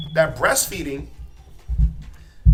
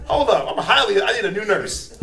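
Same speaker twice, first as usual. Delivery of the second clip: louder, laughing startled protest; laughing alarm; 4 dB louder than usual. Acted. Parent asking for a new nurse, laughing alarm. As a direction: back away laughing in alarm.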